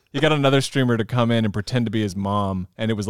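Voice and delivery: ordinary talk, nothing marked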